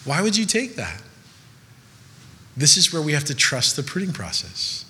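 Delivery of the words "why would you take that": higher, bewildered plea to God; pained why. Retold or imitated. Hurt believer asking God why, pleading. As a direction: cry out why he took it. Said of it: "And you're like".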